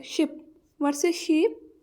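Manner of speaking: शिप with a short i, शीप with a long drawn ee, slowly; slow vowel-length demo. Shown as a demonstration. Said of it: "शीप में long vowel है".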